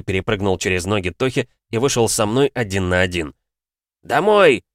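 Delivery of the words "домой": loud, high yell to a teammate; shout. Acted. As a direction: yell it across the pitch. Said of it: "закричал я Михасю".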